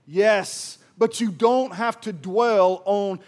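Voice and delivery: loud, slow, emphatic sermon delivery; full-force preaching. Acted. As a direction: drive each line home to the congregation.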